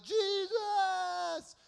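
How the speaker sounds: high, loud cry of a blind beggar; desperate scream. Acted. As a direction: scream his name across the crowd. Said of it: "crazy Bartimaeus starts screaming out".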